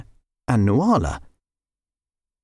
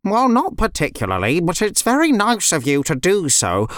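raised, put-on chicken voice; polite chicken; 5 semitones higher than usual. Red Ribbon answers the girls' question about food, polite. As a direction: politely explain as a chicken character.

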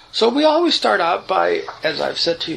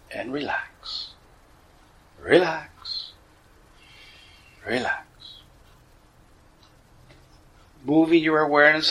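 measured cues with long pauses, softening on each relax; guided meditation. Acted. Teacher leading listeners through relaxing the body, guided meditation voice.

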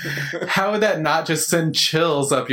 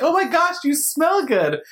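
much higher, delighted squeal; gushing compliment. Retold or imitated. Stranger excitedly praising a scent, high delighted squeal. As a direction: gasp it out like a thrilled stranger. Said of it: "But if they're like".

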